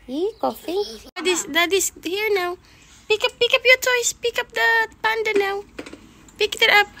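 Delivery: high, loud excited cooing. Real, not acted. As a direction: hype the dog for daddy. High talk to the dog.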